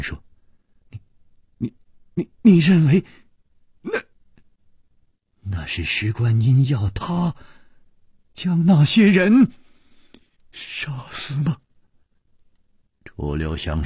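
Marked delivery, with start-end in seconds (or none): 0.9-11.6 high, halting, disbelieving question; shaken stammer. A narrator's reading. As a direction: stumble over the first word, voice climbing. Shaken husband stammers at accusation. (震惊得结结巴巴，高声追问)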